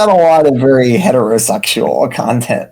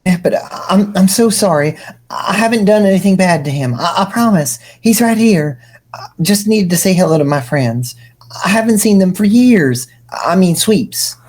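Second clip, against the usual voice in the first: higher, hushed and sorry, rushing; contrite; 7 semitones higher, faster than usual. Acted. The prophet apologising contritely to Nepeta. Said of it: "said contritely".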